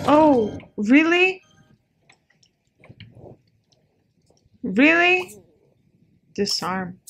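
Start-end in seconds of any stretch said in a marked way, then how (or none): 0.1-5.3 exasperated surprise. Real, not acted. Another trap appears.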